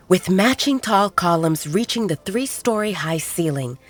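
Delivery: plain reading voice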